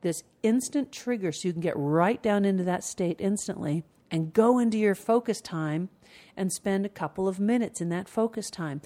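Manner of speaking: ordinary talk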